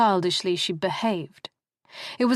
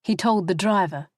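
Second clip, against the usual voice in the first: usual speaking voice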